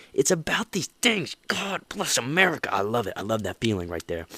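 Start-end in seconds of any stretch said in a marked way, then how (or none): 0.1-2.7 much higher, suddenly impassioned; fired up. Real, not acted. Host fired up about helping others, suddenly impassioned. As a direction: burst into rousing enthusiasm. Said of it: "I just turned a gear".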